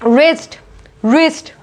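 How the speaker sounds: रिस्ट with a plain R; silent W. Shown as a demonstration. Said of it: "उसको क्या बोलते हैं".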